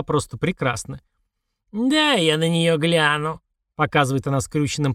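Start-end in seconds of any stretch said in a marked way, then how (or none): 1.7-3.4 put-on old woman voice. A narrator's reading. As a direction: drawl it like a sly old woman.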